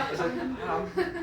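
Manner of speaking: "palm" stretched out slowly, with a laugh; drawn-out demo. Shown as a demonstration.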